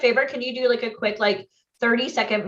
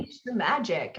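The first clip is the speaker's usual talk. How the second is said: low announcer-style read; promo tagline voice; 4 semitones lower, 6 dB quieter than usual. Pretend. Host reading a slogan in a low trailer voice. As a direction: deliver it like a product slogan. Voice actor tagline, far timbre.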